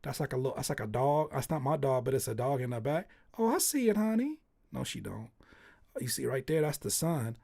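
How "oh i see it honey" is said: high sweet reassurance; mock indulgent praise; humoring parent. Pretend parent humoring a child about the drawing, high and sweet.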